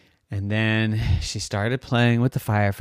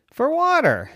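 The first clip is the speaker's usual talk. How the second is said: usual speaking voice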